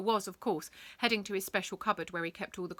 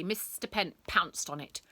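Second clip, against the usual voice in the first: plain reading voice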